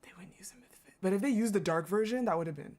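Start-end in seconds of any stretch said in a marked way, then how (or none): 0.0-0.9 whispered, breathy and unvoiced. Real, not acted. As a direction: whisper it to yourself. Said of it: "repeats line, then whispers".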